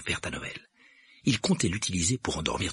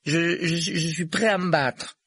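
put-on high, slow, stuttering boy voice; nervous but determined schoolboy; stammering defiance; 12 semitones higher, 5 dB louder, slower than usual. A narrator's reading. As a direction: stumble into a brave challenge, pitched up. Neville stammers his defiance.

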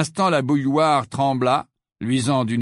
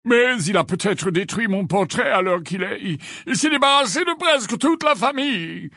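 high, affected grumbling; high-pitched reluctant excuse; Phineas, protesting; 9 semitones higher than usual. A narrator's reading. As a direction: object in a fussy raised voice.

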